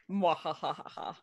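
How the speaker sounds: slow evil cackle; villain gloating laugh, drawn out; mad scientist. Pretend. Villain laugh after excited barbarian.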